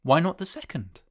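high, feverish challenge. A narrator's reading. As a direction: press the question eagerly. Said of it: "said the old woman feverishly".

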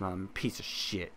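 ordinary delivery

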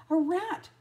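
high, loud shout of alarm; urgent storybook yelp; alarmed cry. A narrator's reading. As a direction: cry out a warning. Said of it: "cried Lady".

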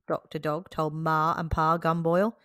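ordinary delivery, nothing marked

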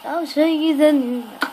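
loud, upbeat host announcement; excited. Real, not acted. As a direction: announce it with energy. Re-announcing map to viewers.

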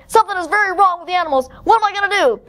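high, panicked rush; alarmed shouting. Acted. Keeper alarmed about the animals, panicked shouting. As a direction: blurt it out in a fluster. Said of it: "he shouted".

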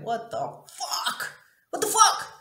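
reenacted anguished cry, building to a high shout; mock wail that climbs and bursts; wailing outburst. Woman reliving her collapse on the floor, wailing outburst. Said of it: "started fucking wailing and crying".

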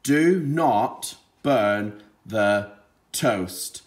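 "Do not burn the toast." read very slowly, word by word; slow plain reading. Shown as a demonstration. Teacher modelling slow reading before the child's turn. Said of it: "Your turn to read it".

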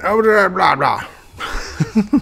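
high mocking blah-blah; goofy dismissive babble; silly blah. Real, not acted. Gamer mocking the lag, high silly blah.

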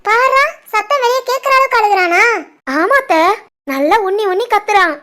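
ordinary talk, nothing marked

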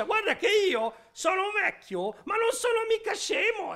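put-on rising, indignant old man; huffy grandpa defending himself; offended protest. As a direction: bristle at being called dumb.

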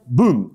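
short, punchy vocal boom; mock boom. Comic imagines a trapdoor for every blunder, punchy mock boom. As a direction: make a loud trapdoor bang. Imita la trampilla al abrirse.

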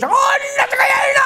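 very high-pitched mock cry in bed; hammed-up high wail; mock Japanese woman. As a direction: squeal it out as a caricatured Japanese woman. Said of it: "he goes".

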